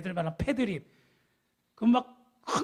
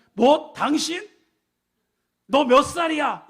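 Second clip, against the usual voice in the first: higher, much louder affronted challenge; mock loud indignation; 5 semitones higher, 8 dB louder, slower than usual. Offender to the other driver in an age fight, mock outraged shouting. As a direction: act out picking a fight at full voice. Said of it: "목소리를 크게 하고 감정으로".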